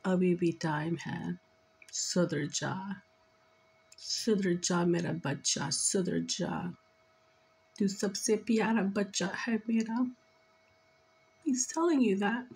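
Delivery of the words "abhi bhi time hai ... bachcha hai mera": low-pitched father pleading with his daughter; lightly voiced father, pitched down; channeled father. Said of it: "He's saying".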